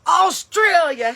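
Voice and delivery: drawn-out call. Acted. Calls out Australia stretched.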